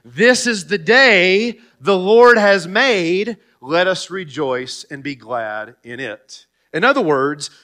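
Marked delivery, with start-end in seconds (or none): 0.0-6.5 congregational recitation. Shown as a demonstration. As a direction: recite it slowly with the church, rising on Lord. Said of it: "Say it with me".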